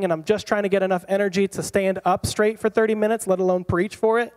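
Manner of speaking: stressed excuse-making. Acted.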